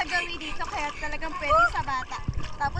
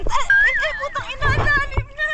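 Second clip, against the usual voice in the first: startled shout; 9 semitones higher, 8 dB louder than usual. Real, not acted. Startled by deep water.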